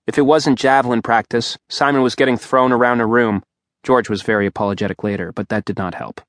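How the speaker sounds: plain reading voice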